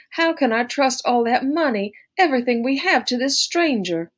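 high, pleading wail. A narrator's reading. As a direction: keep wailing in desperation. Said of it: "she wailed".

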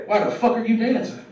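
ordinary talk

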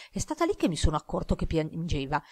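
ordinary delivery, nothing marked

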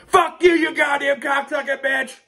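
slow, high, loud rage shout; mock gamer scream in the night. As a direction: scream it at the kid across the house. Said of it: "yell and scream".

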